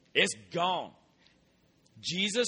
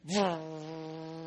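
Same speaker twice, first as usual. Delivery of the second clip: buzzing noise. Retold or imitated. Imitating yellow jackets buzzing.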